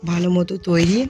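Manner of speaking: ordinary talk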